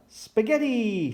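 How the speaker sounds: high, drawn-out delighted cheer; gleeful storybook cheer. A narrator's reading. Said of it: "cheered Pepper".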